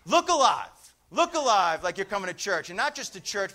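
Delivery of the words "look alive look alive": rousing call. Real, not acted. Preacher repeats his call.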